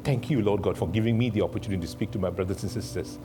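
closing prayer. Real, not acted. Preacher praying for the congregation, earnest prayer. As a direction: pray aloud in steady short phrases.

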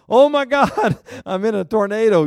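high, loud panicked shout; panicked outcry. Retold or imitated. Pastor caught inside a tornado, panicked loud shout. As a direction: cry out in sudden terror.

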